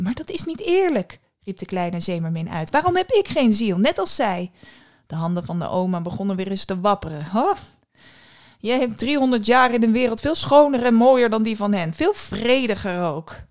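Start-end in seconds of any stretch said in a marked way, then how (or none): 0.0-1.2 high, upset cry of unfairness; indignant protest. A narrator's reading. Mermaid protests. 2.7-4.5 high, louder pleading question; indignant plea. A narrator's reading. Mermaid protests. 7.2-13.4 put-on lively old grandmother, louder; excited grandmother. A narrator's reading. Grandmother soothes.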